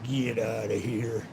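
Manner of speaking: slow, low, quiet impression of the lady; imitating drunk lady. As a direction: mimic her drawn-out, low command. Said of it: "Yeah, she said".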